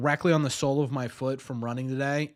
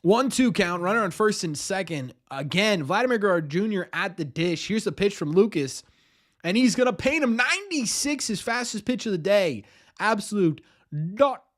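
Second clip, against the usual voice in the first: lively play-by-play call; announcer voice; 3 semitones higher than usual. Acted. Streamer calling a game for chat, in announcer voice. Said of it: "Do you like my announcer voice".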